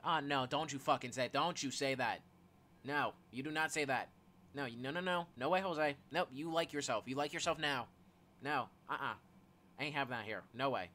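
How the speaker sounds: loud, emphatic refusal to hear it; insistent protest. Acted. Friend objecting to another's self-dislike, insistent protest. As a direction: cut them off with loud, firm reassurance.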